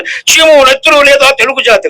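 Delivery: lightly acted stage-play hero, pitched high; historical-drama hero. Speaker quoting a historical play to the audience, lightly declaimed hero. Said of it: "మీరు నాటకాల్లో ఇప్పుడు శివాజీ".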